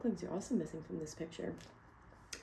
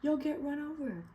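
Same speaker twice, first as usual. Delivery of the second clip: flustered warning; 8 semitones higher than usual. A narrator's reading. Missus Mallard frets to her husband about traffic, high and flustered. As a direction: flap and fret at the danger. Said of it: "squawked Missus Mallard all of the dither".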